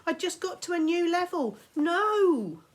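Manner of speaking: high, loud protesting No; shout. Acted. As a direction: wail at being dragged away. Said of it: "as he shouted".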